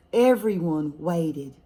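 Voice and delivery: ordinary delivery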